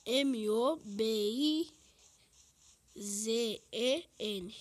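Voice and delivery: slow, clear letter-by-letter spelling; spelling slowly. Shown as a demonstration. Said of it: "tudo minúsculo".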